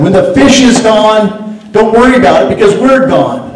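forceful warning. Retold or imitated. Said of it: "I said".

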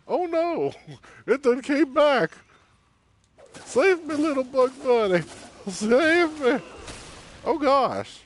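high, loud cries for help; panicked screams. Real, not acted. Player begging the little bug to save her, high and loud.

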